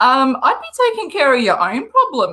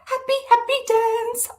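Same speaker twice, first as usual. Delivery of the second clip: gleeful; 11 semitones higher, 3 dB quieter than usual. Real, not acted. Gleeful cheer.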